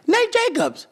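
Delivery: high, surprised call of his name; a lightly voiced lady calling out; woman calling out.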